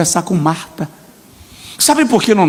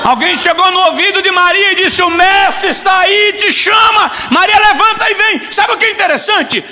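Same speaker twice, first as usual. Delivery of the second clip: full-force preaching; 13 semitones higher, 3 dB louder than usual. Acted. Preacher rouses the congregation in a high full-force cry. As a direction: preach it out at the top of the voice.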